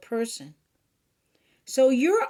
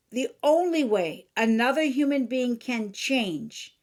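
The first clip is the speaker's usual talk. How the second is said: ordinary talk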